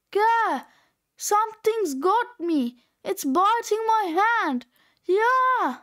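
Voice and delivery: high, hammed-up cry of alarm; mock panic. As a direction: fake being bitten, yelping. Grandpa fakes being bitten.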